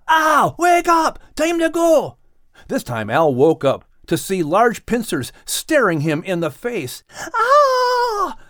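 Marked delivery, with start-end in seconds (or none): 0.1-2.2 slow, high shouted wake-up call; loud yell. A narrator's reading. Max yells at sleeping Al to wake, loud. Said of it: "Max yelled loudly to Al". 7.3-8.4 high yelp of fright; startled cry. A narrator's reading. Al wakes to crab pincers, yelping in fright. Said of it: "cried Al".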